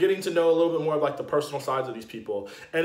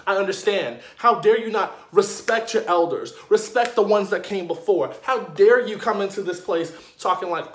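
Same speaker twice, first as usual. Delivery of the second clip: raised, emphatic telling-off; indignant scolding; 3 semitones higher, 3 dB louder than usual. Acted. Fan demanding respect for the elders, indignant. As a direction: lay into them, punching the key words.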